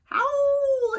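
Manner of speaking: hammed-up wolf howl for kids; mock howl. Howling at the moon.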